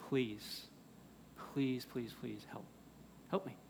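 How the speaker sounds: soft, spaced-out plea; begging. Acted. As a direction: plead quietly, pausing after each please. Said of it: "he starts begging Jesus".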